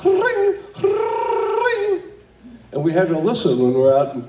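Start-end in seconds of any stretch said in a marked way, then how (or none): 0.0-0.6 short, high trilled ring; brief high-pitched bell trill; short phone ring. Retold or imitated. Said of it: "a short and a long". 0.8-2.0 long, high trilled ring; drawn-out high-pitched bell trill; long phone ring. Retold or imitated. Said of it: "a short and a long".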